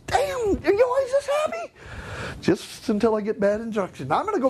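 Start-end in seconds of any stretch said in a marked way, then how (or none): 0.1-1.7 high, incredulous quoted coworker; amazed. Retold or imitated. Coworkers asking about his good mood, amazed high voice. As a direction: sound stunned by his cheer.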